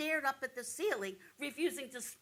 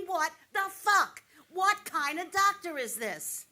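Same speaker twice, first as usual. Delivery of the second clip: higher, louder, slow outburst through a laugh and a sob; outraged, near tears; 6 semitones higher, 7 dB louder, slower than usual. Real, not acted. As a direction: let the anger crack into a teary laugh. Outrage at the doctor.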